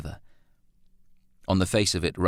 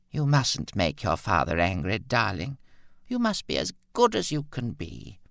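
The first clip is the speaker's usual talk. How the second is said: put-on elderly mother's admonition; 3 semitones higher than usual. A narrator's reading. Confused mother scolds grown son.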